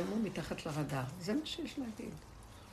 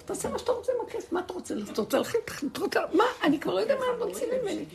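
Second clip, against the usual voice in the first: high, fast, louder rant with laughter; mock exasperated yell; 11 semitones higher, 5 dB louder, faster than usual. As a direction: fling the words out like a fed-up manager. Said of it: "הוא התחיל לצעוק". (צעקה מחוקה, גבוהה ומהירה, בחצי צחוק)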